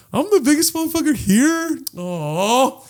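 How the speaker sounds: high-pitched, touched squeal of disbelief; flattered gushing. Retold or imitated. Guest told he's the biggest one here, flattered gushing. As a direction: gush like you got an adorable compliment.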